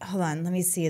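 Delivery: whispered hold on while searching. Real, not acted. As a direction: whisper it under the talk. Searching her phone mid-talk.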